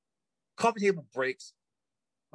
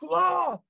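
high imitated "ah" scream; flailing yell; 12 semitones higher, 4 dB louder than usual. Storyteller imitating his flailing friend, high yell. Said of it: "And he's just like".

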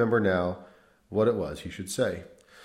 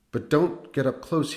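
warning; 6 semitones higher than usual. A narrator's reading. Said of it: "he warned Rudolph".

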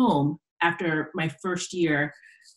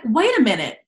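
louder, higher mock amazement; amazed friends; 5 semitones higher, 4 dB louder than usual. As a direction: act out the wide-eyed revelation. Quoting friends' amazed realization.

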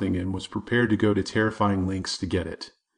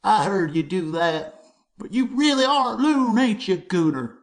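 mocking bully; 12 semitones higher, 4 dB louder than usual. A narrator's reading. Clayton the bully jeering at Jimmy, high and sneering. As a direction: drag out the insult to belittle him.

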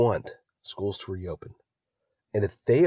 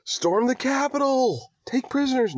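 mock rallying cry. Host joking about getting carried away, mock rallying cry. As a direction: play a carried-away agitator, pitched way up. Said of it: "next few words out of your mouth is gonna be".

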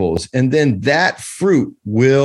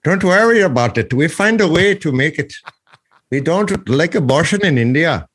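a playful put-on Indian accent, pitched up; mock Indian accent; 4 semitones higher than usual. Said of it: "why does my side always talk in a strange accent".

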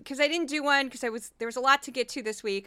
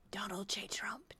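whisper; 3 semitones lower, 14 dB quieter than usual. Retold or imitated. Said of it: "and a brief Kari Lake".

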